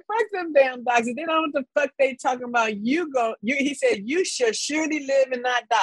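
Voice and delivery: rough, dismissive dad quote, barely put on; retelling her dad's blunt words; lightly voiced gruff dad. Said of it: "He's like".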